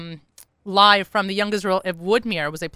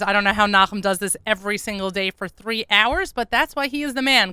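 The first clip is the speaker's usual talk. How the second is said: normal talking voice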